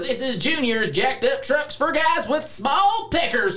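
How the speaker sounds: a small-town dealer bellowing at viewers to come buy trucks; shouted country ad. Acted. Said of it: "You gonna use your country accent?".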